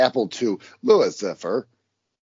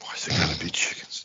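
whispered Indiana Jones; 8 semitones lower, 6 dB quieter than usual. Retold or imitated. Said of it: "Indiana Jones line".

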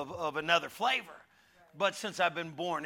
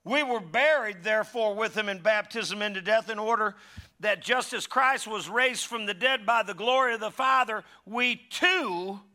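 loud, raised scripture reading; preaching; 5 semitones higher, 6 dB louder than usual. Acted. Preacher reading Romans to the church, loud and raised. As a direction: proclaim it to the congregation. Reads scripture at full force.

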